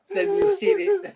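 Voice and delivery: high, breaking into laughter; words squeezed out through a laugh. Real, not acted. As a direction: voice cracking high with real laughter.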